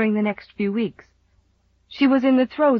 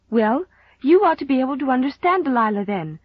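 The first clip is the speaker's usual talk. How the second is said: higher-pitched, insistent comeback; rallying protest; 6 semitones higher, 3 dB louder than usual. A narrator's reading. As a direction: regroup and press the point on Mother. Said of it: "rallying her confused wits".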